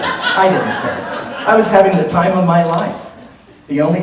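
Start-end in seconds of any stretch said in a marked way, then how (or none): 0.0-1.1 laughing. Real, not acted. Repeats it, slower, laughing.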